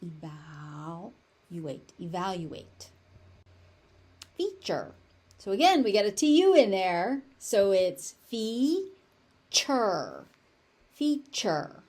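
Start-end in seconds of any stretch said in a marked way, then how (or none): 0.0-1.9 slow, softer "Evaluate", lingering on val; broken into parts. Shown as a demonstration. Teacher breaks word into parts. 8.3-10.3 slow "feature", fee... chur; long gap between the syllables of "feature". Shown as a demonstration. Teacher breaks word into syllables.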